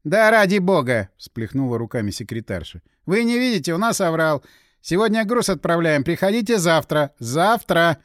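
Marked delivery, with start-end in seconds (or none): 0.0-1.1 loud, higher-pitched burst of exasperation; exasperated secretary. Acted. Secretary exasperated, throws up hands. 3.0-8.0 higher, insistent brush-off; harried secretary. Acted. Harried secretary rebuffs insistent visitor.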